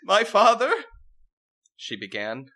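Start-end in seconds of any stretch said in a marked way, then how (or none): 0.0-0.7 high, loud mock-regal princess voice; haughty princess. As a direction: play the rebellious princess grandly. Princess mourns her father.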